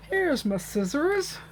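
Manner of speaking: playful fake US accent, drawn out; mock American. Crafter finding her scissors, in a mock American accent. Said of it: "sort of like a mock American".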